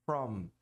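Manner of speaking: "From" with lips closed at the end; full final M. Shown as a demonstration. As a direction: shut the lips on "From". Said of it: "e não from".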